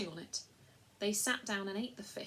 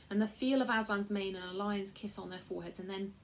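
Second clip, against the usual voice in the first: plain reading voice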